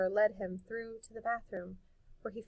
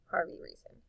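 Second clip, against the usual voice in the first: plain reading voice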